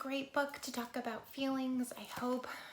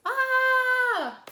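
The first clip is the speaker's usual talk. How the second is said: very high, loud held scream; full-voiced tantrum scream, acted; storybook scream; 12 semitones higher, 15 dB louder than usual. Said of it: "She screams".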